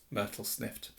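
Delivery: ordinary delivery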